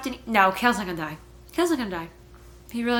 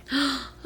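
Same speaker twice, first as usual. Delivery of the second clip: sharp shocked gasp; 4 semitones higher than usual. Real, not acted. Shocked by sword on neck.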